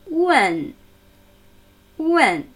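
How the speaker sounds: "Wen" gliding from u to ö, falling; vowel demonstration. Shown as a demonstration. Teacher showing the vowel to non-English speakers, "Wen" falling. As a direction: say "Wen" high and drop it. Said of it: "Der Vokal in diesem Wort".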